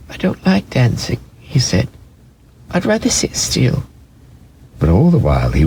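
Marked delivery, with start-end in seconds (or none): 0.1-3.8 high, small toy-rabbit voice; a shy little rabbit brushing it off. A narrator's reading. Toy rabbit refusing to play, high and small.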